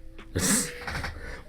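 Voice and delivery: soft unvoiced laugh; airy giggle under breath; breathy laugh. Real, not acted. Laughs at Brooklyn remark.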